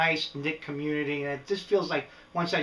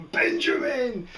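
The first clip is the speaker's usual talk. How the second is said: higher sharp call of the name; 9 semitones higher, slower than usual. Real, not acted. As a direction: call the pet back. Calling his escaping lizard.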